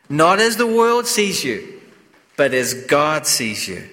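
ordinary talk, nothing marked

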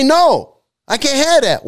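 high, emphatic acted refusal; punchy, high-pitched no; firm refusal. Acting out refusing temptation.

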